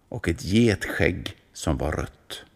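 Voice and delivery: plain reading voice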